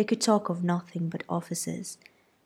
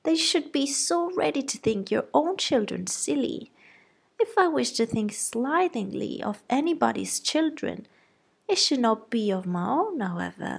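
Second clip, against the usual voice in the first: high-pitched fretting mother; flustered wife defending her girls; fussy mother; 6 semitones higher than usual. A narrator's reading. Mrs Bennet voiced.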